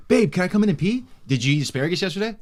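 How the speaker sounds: imitating the girlfriend. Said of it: "she's knocking at the door".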